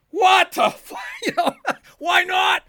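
high, loud furious yell with a laugh breaking in; angry father shouting. Retold or imitated. Father yelling at the doctor from the dressing room, furious shout. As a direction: roar it out like an outraged hockey dad. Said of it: "I could still hear my father's voice above every".

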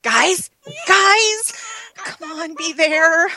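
shouting for friends; calling out. Acted. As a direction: call out loudly.

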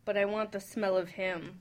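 ordinary talk

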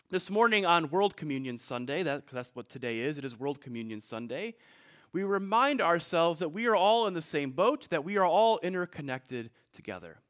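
ordinary delivery

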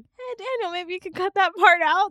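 high, loud fussy manager; pushy manager voice. Retold or imitated. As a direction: nag like a worried manager. Imitating manager's request.

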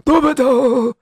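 put-on high thin old man calling at the door; a hint of a frail old man. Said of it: "a very old man who came knocking".

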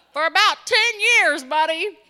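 high, very loud mock-exasperated retort; emphatic comeback. Niece answering her uncle, loud mock exasperation. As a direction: belt out the answer to a clueless relative.

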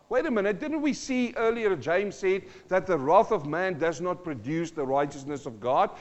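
ordinary delivery

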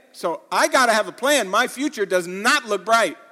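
emphatic pulpit delivery; full-force preaching. Real, not acted. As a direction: preach it loud and high to the whole room.

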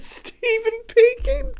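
high, slow robot voice; drawn-out mock speech synth. Says the character name, laughing.

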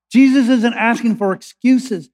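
ordinary talk

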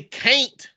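"can't" as "cain't", rhyming with paint; Southern pronunciation. Shown as a demonstration. Arguing others don't say it, "can't" as "cain't". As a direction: stretch "can't" into a long "cain't". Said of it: "In the South, we say can't".

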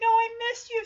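high, gushing reunion voice; Sassy. Acted. Cat reunited with the dogs, high and gushing. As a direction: gush it high, mock tearful. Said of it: "she's like".

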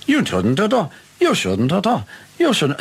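high chant repeated identically; imitated record loop. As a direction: mimic a skipping snippet, pitched high. (hoge herhalende plaat nadoen)

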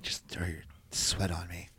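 breathy teasing whisper; mock whisper. Cohost teasing about the sweat throw, in a mock whisper. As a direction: hush it like a naughty secret.

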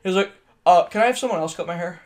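usual speaking voice

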